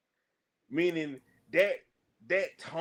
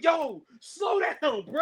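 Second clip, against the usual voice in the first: high, slow, laughing mock scream; mock panicked yell; 11 semitones higher, slower than usual. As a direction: squeal it like a scared little kid. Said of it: "like two little kids screaming".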